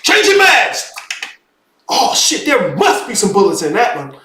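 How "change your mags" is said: mock video game soldier shouting a reload warning; game voice callout. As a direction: yell it like a video game squadmate. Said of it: "heard the voice go with the reload animation".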